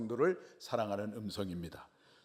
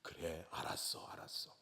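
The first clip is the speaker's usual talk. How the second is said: tender whisper; 7 semitones lower, 13 dB quieter than usual. Retold or imitated. God comforting his beloved in a tender whisper. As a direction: soothe the child gently. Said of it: "가슴이 설레고 너무너무 기뻐가지고".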